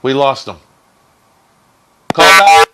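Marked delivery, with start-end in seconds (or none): 2.1-2.7 calling out. Real, not acted. Calling out to lost caller.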